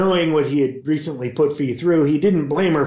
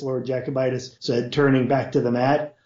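ordinary delivery, nothing marked

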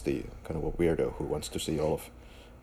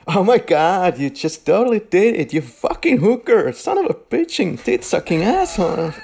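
screaming, much higher, foul-mouthed rant; 14 semitones higher, 6 dB louder than usual. Retold or imitated. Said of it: "that was a great accent".

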